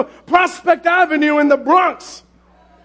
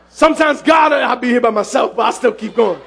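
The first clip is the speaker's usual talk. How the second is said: usual speaking voice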